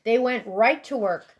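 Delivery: ordinary delivery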